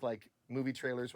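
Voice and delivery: ordinary talk, nothing marked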